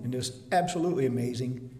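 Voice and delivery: ordinary delivery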